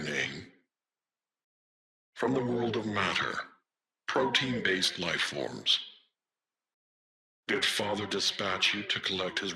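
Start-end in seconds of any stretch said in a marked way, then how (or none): none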